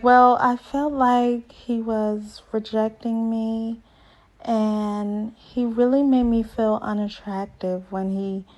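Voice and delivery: soft, slow, sulky imitation of Bao; a hint of her meek, hurt way of talking; imitating Bao's talk. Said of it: "Y'all know how she talk".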